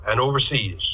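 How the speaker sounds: normal talking voice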